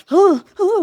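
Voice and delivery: high, loud mock-swooning gasps; exaggerated romantic panting noises; cheesy love-scene gasps. Mocking the film's cheesy love scenes, with swooning gasps. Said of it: "cheesy love scenes with weird noises".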